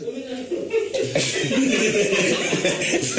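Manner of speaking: high distant laughter; laughing off-mic; far laugh. Real, not acted. Colleague laughing off-mic at wrong number.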